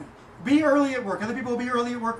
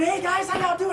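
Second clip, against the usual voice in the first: much higher, cheerful hello; upbeat dad greeting his daughters; good-mood greeting; 9 semitones higher than usual. Retold or imitated. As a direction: come home happy to the kids. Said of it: "If I come home in a good mood".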